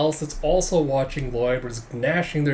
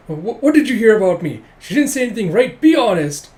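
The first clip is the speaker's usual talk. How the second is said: high, loud, stammering questions; hammed-up frantic worry; flustered panic; 6 semitones higher, 8 dB louder than usual. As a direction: demand the truth in a panic. Boy panics over his secrets.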